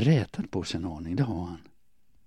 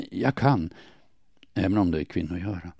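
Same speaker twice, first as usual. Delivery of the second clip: plain reading voice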